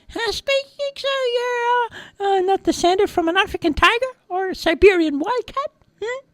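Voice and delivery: very high, squeaky mouse voice; hesitant tiny rodent questioning a cat; nervous little mouse. A narrator's reading.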